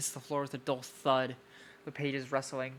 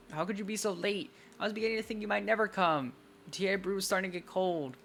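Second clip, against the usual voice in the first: higher-pitched, indignant complaint; pouting Wisteria; 6 semitones higher, 4 dB louder than usual. Acted. Wisteria scolding the late visitor, pouting complaint. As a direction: scold with a pout, pitch raised.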